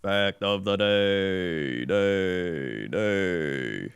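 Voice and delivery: announcer intro with fading echo; echo effect. Pretend. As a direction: say it like a jingle echoing away.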